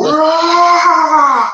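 huge high shout, all out; wrestling-ring battle cry; mock wrestler roar. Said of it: "And he's gonna go".

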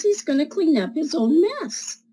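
usual speaking voice

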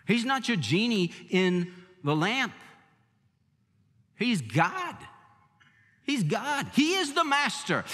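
usual speaking voice

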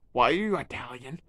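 usual speaking voice

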